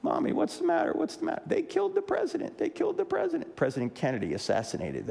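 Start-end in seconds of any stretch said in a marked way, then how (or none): 0.0-1.4 put-on high, small child asking his mother; a toddler tugging at his weeping mother, high child voice. 1.4-3.6 high, mild imitation of a sobbing mother; mother weeping at the TV, mild high-pitched mock grief; imitating weeping mother. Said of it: "weeping uncontrollably".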